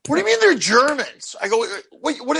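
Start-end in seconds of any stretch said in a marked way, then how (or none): 0.1-1.4 higher, baffled question; disbelief, thrown back at the doorman; incredulous. Retold or imitated. 2.0-2.4 higher, startled double take; a quick stunned double take; stunned comic double take; baffled. Retold or imitated. Retold confusion.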